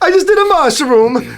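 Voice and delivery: high, loud Mario voice; cheerful cartoon plumber. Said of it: "that checks out".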